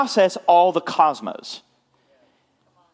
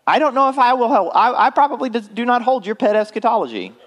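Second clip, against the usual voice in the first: higher, quicker teasing aside; playful teasing; 9 semitones higher, faster than usual. Real, not acted. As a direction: tease them lightly about their pet views. Teasing his audience about their views.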